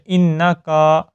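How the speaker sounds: انکا with the کاف drawn out; wrong long kaaf. Shown as a demonstration. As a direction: add an alif after the کاف in انکا. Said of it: "کاف کو تھوڑا سا لمبا کر دیں". (غلطی دکھانے کے لیے کاف لمبا)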